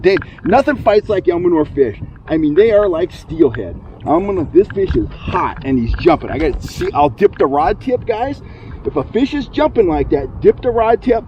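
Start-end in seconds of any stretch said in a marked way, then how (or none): none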